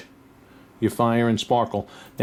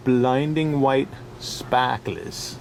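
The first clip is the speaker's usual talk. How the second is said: put-on slow, drawn-out New York accent; 2 semitones lower, much slower than usual. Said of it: "In my classic New York accent".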